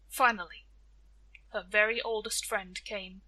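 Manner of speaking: usual speaking voice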